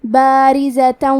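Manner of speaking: بارزة said very slowly with a thin, light ra; clear, slow model reading of بارزة; slow tajweed demo. Shown as a demonstration. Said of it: "ہم حرف را کو پتلی آواز میں پڑھیں گے".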